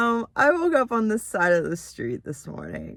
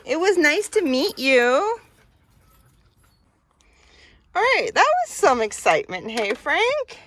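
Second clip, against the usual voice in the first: talking to a dog; 5 semitones higher, 4 dB louder than usual. Real, not acted. Farewell to the dogs.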